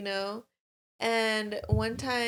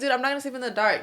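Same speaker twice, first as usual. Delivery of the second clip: hurried scared resolve; 3 semitones higher, much faster than usual. Retold or imitated. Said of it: "'cause I was like".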